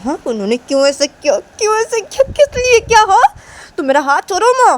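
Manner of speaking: high, agitated demand; worked-up, high-pitched anger; angry outburst. Acted. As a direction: protest hotly, pitch shooting up. Sanju demands why, struggles free.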